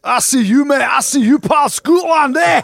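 high, loud jeering call at his mate; taunting shout. Real, not acted. Jeering at his Scottish co-host, high loud taunting shout. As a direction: yell it out to wind up the Scotland fan.

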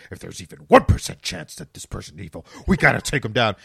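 loud, hammed-up grim warning; mock stern Batman. Mocking the old Batman with a loud grim warning. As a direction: bark the threat with mock gravity.

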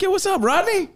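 high-pitched, delighted shout-out; excited greeting. Retold or imitated. Re-enacting the hello to buddy Rodney, high and excited. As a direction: greet an old pal way up high.

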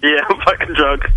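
ordinary delivery

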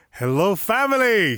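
high, loud burst of greeting; excited greeting. Real, not acted. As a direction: greet long-missed listeners at full excitement. Excited return to the podcast.

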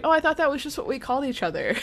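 high, quick mock surprise; imitating Phoebe. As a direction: rush it out high, innocently puzzled. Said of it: "She's like".

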